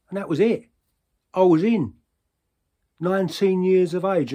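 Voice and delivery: high, ringing climax of a tale; loud proclamation. Acted. Triumphant climax of the enlistment tale.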